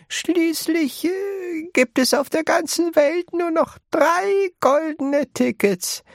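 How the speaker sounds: put-on high, quavery old woman reminding Charlie; old grandma voice. A narrator's reading. Grandma Josephine reminds Charlie of three golden tickets, high. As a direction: hold a high old granny voice.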